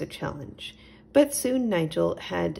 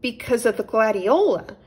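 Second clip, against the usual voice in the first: matter-of-fact; 4 semitones higher, 7 dB louder than usual. A narrator's reading. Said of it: "answered Little Owl matter-of-factly".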